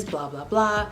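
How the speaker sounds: normal talking voice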